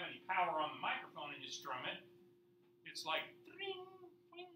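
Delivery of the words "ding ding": high, thin plucked-string ding; tinny guitar ding. Retold or imitated. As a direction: mimic an unplugged electric guitar twang. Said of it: "it's just real tinny".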